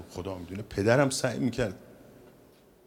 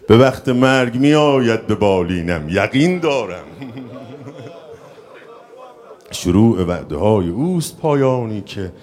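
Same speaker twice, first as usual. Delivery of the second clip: slow, loud declaimed poetry; verse recitation; 8 dB louder, slower than usual. Acted. Speaker reciting devotional verse to audience, slow declamation. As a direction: declaim the verse slowly and with force.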